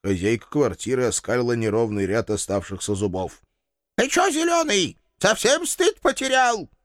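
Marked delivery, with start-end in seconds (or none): 4.0-6.7 put-on much higher old crone's scolding; shrill-free high old woman's rebuke; old landlady. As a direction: shoo him off like a cranky granny. Landlady confronts hero.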